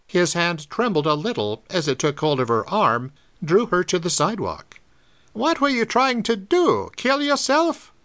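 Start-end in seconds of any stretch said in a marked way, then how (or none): 5.3-7.9 put-on 1 alarmed old druggist. A narrator's reading. Shaken druggist questions girl.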